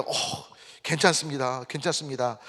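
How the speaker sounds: soft, unhurried, forgiving retold reply; gentle reassurance. Customer reassuring the waiter who spilled wine, gently forgiving. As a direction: play it kindly, easing off the volume. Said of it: "미소로 웨이터를 대한 거예요".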